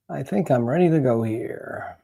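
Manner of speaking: ordinary talk, nothing marked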